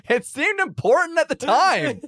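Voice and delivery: high, slow, loud protest; shouted excuse. Acted. Player justifies framing a guy, high drawn-out defensive yell.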